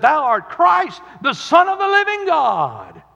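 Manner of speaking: high, loud proclamation; high loud preaching cry; exalted cry. Retold or imitated. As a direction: proclaim it to the rafters. Peter's confession, preached.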